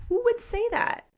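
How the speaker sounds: outrage on his partner's behalf; indignant Eli. Retold or imitated. Eli indignant about Penny's past partners, high indignant. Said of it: "he's just like".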